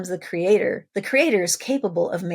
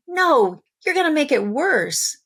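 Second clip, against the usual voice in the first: put-on high, loud childish protest; defiant child pushing back; 7 semitones higher, 4 dB louder than usual. Child rejecting parent's homework help, loud and high. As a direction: snap back like a kid refusing help.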